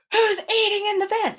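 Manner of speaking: boss yelling. Retold or imitated. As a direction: shout up the van like an angry boss. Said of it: "my boss yells at me".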